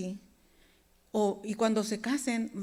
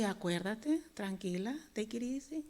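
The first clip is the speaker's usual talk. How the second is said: soft, hushed soothing words; calm yourself down gently; 9 dB quieter than usual. Retold or imitated. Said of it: "Dios me calmaba". (voz suave que tranquiliza)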